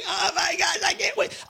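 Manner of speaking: high-pitched squeal of excitement; excited. Retold or imitated. Telling how the bike arrived at the house, excited. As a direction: reenact giddy anticipation in a high, squeaky voice. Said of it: "I was so excited".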